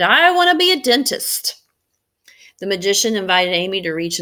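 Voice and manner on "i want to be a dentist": put-on high, loud little girl's call; child shouting. Amy shouting her wish to the magician, high eager child. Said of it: "Amy shouted".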